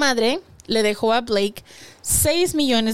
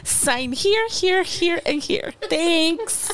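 man doing a woman's voice; 6 semitones higher, much slower than usual. Pretend. Acting out signing prenup.

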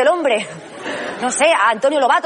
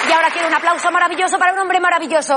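announcer voice; 4 semitones higher than usual. Acted. Presenter introduces next act.

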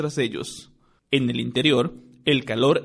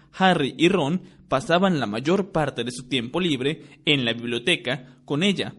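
ordinary talk, nothing marked

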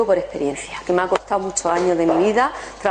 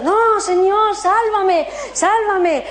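desperate plea; 10 semitones higher, much slower than usual. Retold or imitated. Drowning man refusing the boat, frantic high plea. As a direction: beg the Lord frantically.

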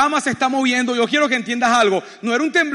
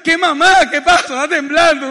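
louder, higher retold retort of a flustered husband; exasperated shout back; 4 semitones higher, 5 dB louder than usual. Husband answers wife mid-earthquake, loud exasperated retort. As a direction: answer back loudly in mock alarm.

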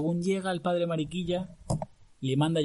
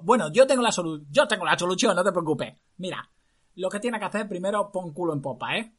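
high, loud clown doctor voice; bossy funny doctor voice; 5 semitones higher, 6 dB louder than usual. Acted. Disguised doctor orders prince, clown voice. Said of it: "Aunque hablaba con esta voz".

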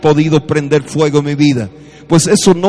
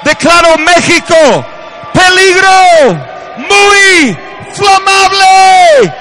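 preaching at full force; 9 dB louder than usual. Acted. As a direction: proclaim it over the nation. Preacher's closing declaration. (predicador que declara a gritos sobre México)

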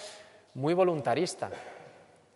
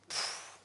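breathy exasperated puff of air; weary puff; 12 dB quieter than usual. Shown as a demonstration. Teacher demonstrating the lazy puff of complaint. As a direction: blow out a tired puff. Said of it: "es el idioma puf".

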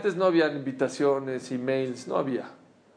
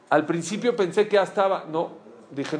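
ordinary talk, nothing marked

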